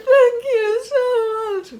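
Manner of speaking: high, loud, crying thank-you; tearful thanks. Retold or imitated. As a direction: sob out your thanks, overwhelmed. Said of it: "burst into tears".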